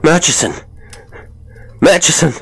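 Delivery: high, loud, excited call; high keen voice. A narrator's reading. Gilday suddenly calls his friend, high and keen. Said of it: "in a high and keen voice".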